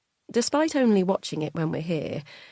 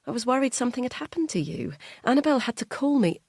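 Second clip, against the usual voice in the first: fast, higher anxious words; worried rush; 6 semitones higher, faster than usual. Acted. Wife tells husband she was worried, fast and anxious. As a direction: let the worry spill out quickly.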